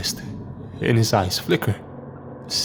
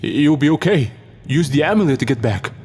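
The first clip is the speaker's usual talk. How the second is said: raised, higher narrated shout; urgent shout; 4 semitones higher, 3 dB louder than usual. A narrator's reading. Struggling man urges the others to escape, shouting. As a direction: call out instructions with some force. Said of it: "he shouts".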